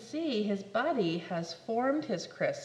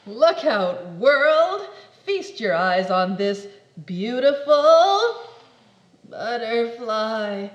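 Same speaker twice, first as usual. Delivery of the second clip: loud, slow sing-song reveal; loud showy fanfare; grand announcement; 11 dB louder, slower than usual. Acted. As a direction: proclaim it. Grand emergence.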